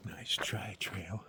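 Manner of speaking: unvoiced whisper. Real, not acted. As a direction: hush it out as a tease. Whispered aside to the trail.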